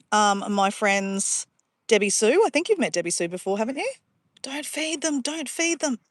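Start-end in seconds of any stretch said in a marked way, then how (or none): 4.4-6.0 pained plea. Real, not acted. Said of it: "It pains me".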